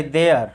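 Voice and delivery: देयर with a sounded final r; r pronounced. Shown as a demonstration. Teacher showing students how not to read it, देयर with r pronounced. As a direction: say देयर the Hindi way, r clearly heard. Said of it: "देयर नहीं पढ़ेंगे इसको".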